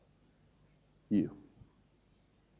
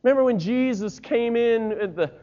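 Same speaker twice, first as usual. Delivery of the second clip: ordinary talk, nothing marked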